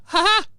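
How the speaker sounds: high-pitched mocking "ha ha"; short, high, derisive cackle; mocking laugh. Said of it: "I can watch and go".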